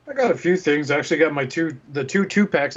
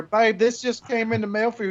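wife voice; 9 semitones higher than usual. Retold or imitated. Voicing a wife.

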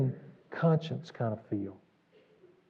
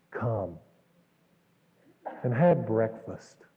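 tender invitation; 7 semitones lower, slower than usual. Retold or imitated. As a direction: invite them in gently. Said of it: "Do you feel the grace there?".

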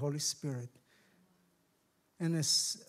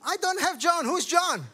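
high, loud comic outburst; alarmed bewilderment; 10 semitones higher, 8 dB louder than usual. Retold or imitated. Newcomer finding no John in his Bible, loud comic alarm. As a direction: cry out in mock panic. Newcomer panics at missing book.

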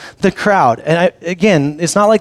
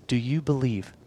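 very soft, low, earnest asking; hushed, direct appeal; face-to-face question; 7 semitones lower, 14 dB quieter than usual. Acted. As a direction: drop the voice low and ask it gravely. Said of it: "he stares them face to face and says".

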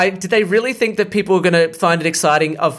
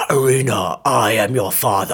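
Darth Vader-achtige imitatie; 6 semitones lower than usual. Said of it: "he goes up to Irina and goes".

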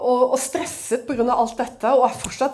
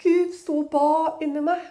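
usual speaking voice

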